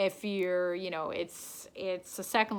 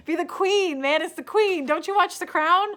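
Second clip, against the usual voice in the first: mock exasperation; 9 semitones higher, 7 dB louder than usual. Mock-scolding viewer who asked about king.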